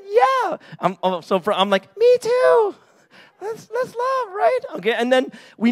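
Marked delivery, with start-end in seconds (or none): 0.0-0.6 squeaky high little yes; imitating a girl. Girlfriend answers the boy in a high squeak. 2.0-4.6 put-on much higher, squeaky kid voice; childish voice. Boy tells his first girlfriend in a squeaky kid voice. His eleven-year-old self replying.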